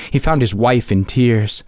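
plain reading voice

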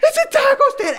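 put-on high, wailing kid's sob-voice; imitating a sobbing child. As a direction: whimper it like a crushed nine-year-old. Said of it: "he just starts sobbing".

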